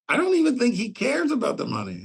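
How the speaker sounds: high, breezy reassurance; imitating himself. Host telling Danielle not to worry about the fee, high breezy imitation. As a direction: toss it off lightly, up high.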